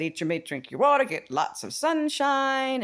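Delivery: sing-song the three rules; mimicking her tips. Quoting her advice to Bill, lilting and playful. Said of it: "No, she said".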